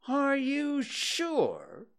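very high, stretched-out question. A narrator's reading. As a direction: draw it out in high-pitched astonishment. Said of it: "still more surprised".

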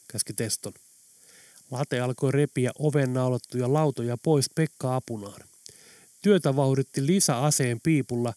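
ordinary delivery, nothing marked

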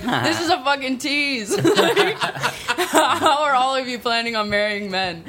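high, laughing mock outrage; amused frustrated quote; laughing exasperation. Laughing exasperated inner thought.

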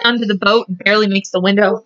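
normal talking voice

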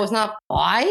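"pie" turned to "boy" in fake English; badly faked English accent on "pie"; botched English accent. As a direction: ask with an overdone English vowel on "pie". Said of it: "say it in my accent".

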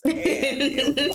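ordinary talk

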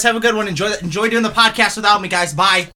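fake-offended farewell; mock sulky exit. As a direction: pretend to storm off. Mock leaving the podcast.

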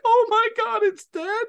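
very high, loud falsetto cry; shocked squeal. Retold or imitated. Bubbly player seeing the skeleton horse, shocked high squeal. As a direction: imitate a bubbly girl crying out in shock.

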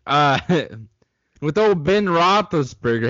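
ordinary talk

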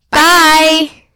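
high, loud, drawn-out call; shouted goodbye. Real, not acted. Redoing the sign-off on three, shouted goodbye. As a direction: belt out the farewell.

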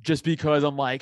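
ordinary delivery

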